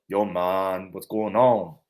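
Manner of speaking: low, laid-back "yo, man" greeting; low-pitched casual dude greeting. Shown as a demonstration. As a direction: drop it down low and easy. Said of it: "Like a lower end version of".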